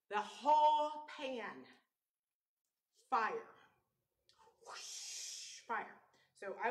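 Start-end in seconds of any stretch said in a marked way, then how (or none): 0.1-3.5 dramatic slow build. Real, not acted. Building suspense in fire story. 4.7-5.6 whispered whoosh. Retold or imitated. Imitating the fire flaring.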